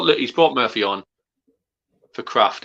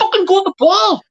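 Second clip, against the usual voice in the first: angry shout at the screen; 6 dB louder than usual. Real, not acted. Fan on stream screams at his team's player, furious yell.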